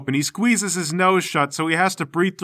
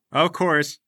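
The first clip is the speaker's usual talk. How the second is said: ordinary talk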